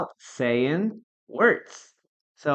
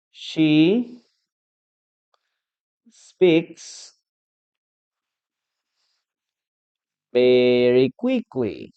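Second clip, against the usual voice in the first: slow dictation; 4 dB louder, much slower than usual. Shown as a demonstration. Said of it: "you can say".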